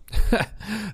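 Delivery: short high laugh at his own line; a light laugh of amusement; quick chuckle to the congregation; amused chuckle. Real, not acted. Laughs after "shut up.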